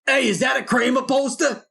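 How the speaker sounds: high, shouted character line; party guest calling out. Acted. Guy at the party notices the poster, high excited shout. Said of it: "the guy who, like, notices the Kramer stuff".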